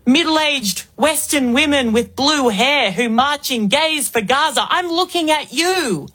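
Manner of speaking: ordinary talk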